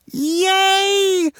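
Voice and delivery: high, loud, drawn-out squeal of joy; excited cheer. Acted. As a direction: cheer like a thrilled four-year-old. Child thrilled at own room.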